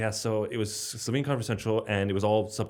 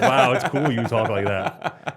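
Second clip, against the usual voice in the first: amused wonder; 3 semitones higher, 9 dB louder, slower than usual. Retold or imitated. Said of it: "you're like".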